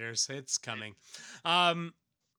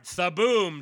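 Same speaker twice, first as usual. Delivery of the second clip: boom sound effect; 6 semitones higher, 9 dB louder than usual. Pretend. Sound effect in comic recap.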